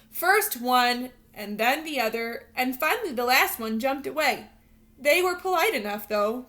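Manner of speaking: expressive read-aloud storytelling voice; storybook narration. Acted. Teacher reading a picture book to her students, lively storytelling voice. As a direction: read it like a bedtime picture book for little kids.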